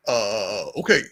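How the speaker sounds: low Obama uhs and okays; Obama impression. Podcaster demos Obama voice for friends. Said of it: "he does that a lot".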